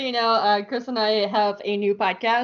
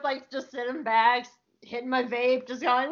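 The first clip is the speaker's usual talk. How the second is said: ordinary talk, nothing marked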